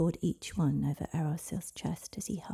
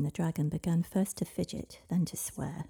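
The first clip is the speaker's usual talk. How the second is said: ordinary delivery, nothing marked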